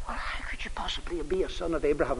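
unvoiced hushed question; whisper. Wife whispering back in the restaurant corner, whispered. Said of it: "we're now all talking in whispers".

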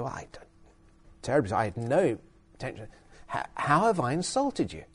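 very soft, halting retold reply; hushed protest of innocence, acted lightly; soft, flustered apology. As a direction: back off quietly, stumbling over the apology. Acting his apologetic reply to accusation.

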